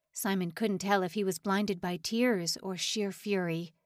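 plain reading voice

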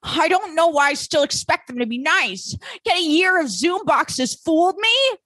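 high, hurt and self-pitying; wounded new girl. Retold or imitated. Kate lamenting the mean students, high and hurt. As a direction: pitch it up like a disappointed newcomer. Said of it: "Kate's like".

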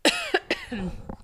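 short dry cough. Real, not acted. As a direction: cough to clear an itchy throat. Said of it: "My throat is itchy".

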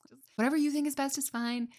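helpless panic, handing the choice to the clerk; panicked. Retold or imitated. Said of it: "like, panicked".

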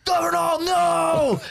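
very high, long drawn-out yell; hammed-up howl of despair; mock wailing protest. Imitating friends as the girls run off, mock wailing protest.

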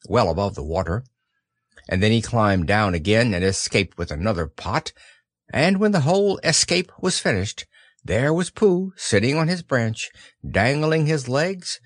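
ordinary delivery, nothing marked

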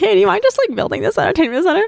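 high, fast wheedling ask; butter up a friend for a favor; mock innocent request; sweet begging.

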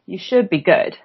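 ordinary delivery

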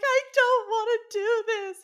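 high, loud protesting wail; retold yell. Kelsey to Zach on hearing it's a dead mouse, high retold yell. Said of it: "Immediately just, like, yelled".

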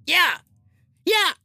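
loud, fast, angry comic celebrity impression; Gilbert Gottfried voice. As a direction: blurt it out loud like a cranky comedian. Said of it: "Gilbert Gottfried".

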